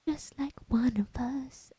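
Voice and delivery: soft unvoiced whisper, slowed down; whispered aside. Real, not acted. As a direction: let it slip as a secret to the viewers. Conspiratorial aside to viewers.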